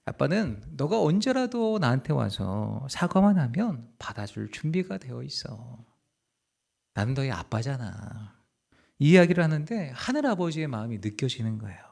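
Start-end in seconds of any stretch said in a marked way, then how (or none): 0.0-8.3 soft, slow, hushed reassurance while hugging; tender father. Retold or imitated. Father hugs his daughter, soft slow tender reassurance. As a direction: hold her close and promise gently. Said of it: "꼭 안아주면서".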